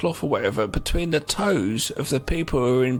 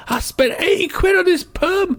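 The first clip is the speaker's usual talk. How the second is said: much higher, louder mock outrage; indignant high mimicry; 14 semitones higher, 3 dB louder than usual. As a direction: squawk it like the vain crook. Quoting the permed crook.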